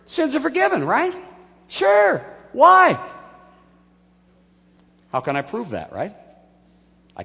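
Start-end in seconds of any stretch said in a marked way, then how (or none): 0.1-3.0 high, loud, offhand exclamations; breezy mock claim. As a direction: toss it out as if it were obvious. Acts out the easy claim.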